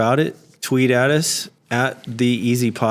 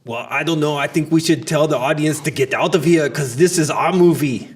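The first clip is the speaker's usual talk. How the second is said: put-on thick Austrian action-hero accent; Austrian-accented action star impression; Arnold. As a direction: play an Austrian movie tough guy. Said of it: "If you cannot handle Arnold".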